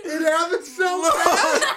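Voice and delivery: laughing through disgust; half laughing, half crying. Real, not acted. Choking on a gross jelly bean.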